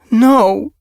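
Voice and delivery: high, loud shocked cry; horrified cry. Acted. Mother hearing a kit drowned, crying out in horror. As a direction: recoil in horror at the news. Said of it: "eyes stretched wide with horror".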